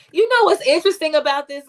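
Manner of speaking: normal talking voice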